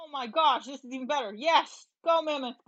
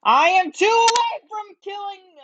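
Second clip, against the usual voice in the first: excited; 6 semitones higher, 8 dB louder than usual. Real, not acted. About to win, excited.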